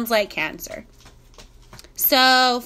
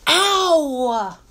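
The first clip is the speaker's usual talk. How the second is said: long, falling cry of pain; pained yelp. Real, not acted. Owner scratched by her dog, pained yelp. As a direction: yelp "ow" and let it slide down.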